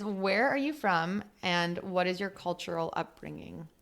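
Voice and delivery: normal talking voice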